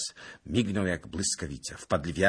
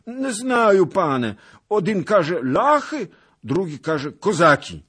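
put-on wailing old beggar; 14 semitones higher, 12 dB louder than usual. Beggar tells the lord conflicting rumours, wailing. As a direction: plead ignorance at the top of the voice. Beggar wails uncertain answer.

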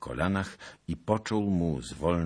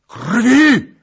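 very high, very loud howl; howling for blood; 16 dB louder than usual. Acted. Bohun howling in frenzy, a wild howl. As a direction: howl it like a madman. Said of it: "wył Bohun".

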